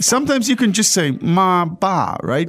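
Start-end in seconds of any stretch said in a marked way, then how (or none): 1.2-2.2 ma, ba as single high syllables; shortened form. Shown as a demonstration. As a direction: cut mama and baba down to ma, ba. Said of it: "to really shorten it".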